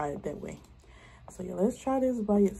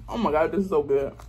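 speaking between bites; talking while chewing. Real, not acted. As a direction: mouth partly full of food. Seen 0: Ate at -5, lips work as she talks holding bread.

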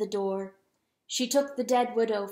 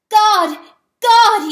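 very loud, high outcry; anguished cry; 11 semitones higher, 18 dB louder than usual. A narrator's reading. Weasel wringing paws over slain serpent, anguished cry. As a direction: scream it out in horror and loathing. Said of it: "he cried".

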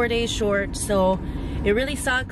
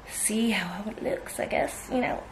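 whispering; 4 dB quieter than usual. Real, not acted. Trailing off into a whisper.

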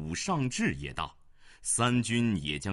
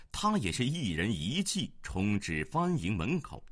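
usual speaking voice

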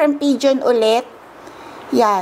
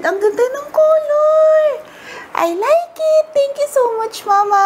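delighted gushing; 12 semitones higher than usual. Real, not acted. Daughter thanks her mama for the gift, delighted. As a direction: gush with thanks at the top of the voice.

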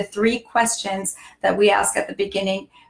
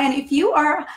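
normal talking voice

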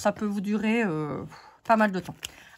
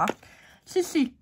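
ordinary talk

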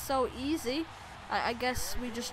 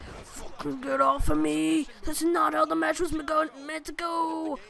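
louder, slow mock-angry wrestler rant; angry Triple H rant; 4 semitones higher, 4 dB louder than usual. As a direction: growl it like a furious wrestler shoving off his opponent. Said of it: "He's like".

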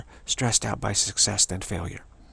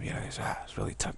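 soft, whispered sympathy; hushed bad news; 9 dB quieter than usual. Telling a friend bad news to comfort him, whispered.

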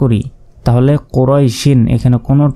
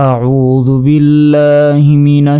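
slow melodic tajweed chant; slow, reverent Quranic recitation; 3 semitones higher, much slower than usual. Shown as a demonstration. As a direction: recite slowly in a drawn-out chant.

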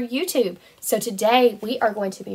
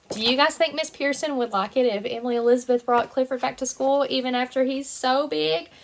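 plain reading voice

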